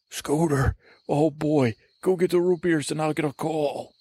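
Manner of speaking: a hint of an eager little sidekick. Sidekick [name] eagerly sending the host for root beers.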